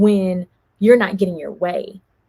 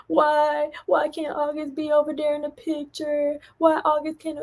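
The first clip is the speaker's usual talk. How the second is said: higher mock child's whine; imitating a screaming child; 8 semitones higher than usual. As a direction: whine it like a three-year-old who can't have his way. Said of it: "all you can hear him is screaming".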